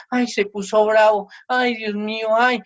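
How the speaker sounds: acting out a worried parent's fretting; mildly hammed-up dread of an angry child; mock fearful parent. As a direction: fret like a cowed parent. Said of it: "le tienen miedo a los hi".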